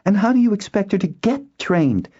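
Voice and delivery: very high, tense challenge; strained, indignant question; tight-voiced. A narrator's reading. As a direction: ask it through tight anger. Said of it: "inquired in a tight voice".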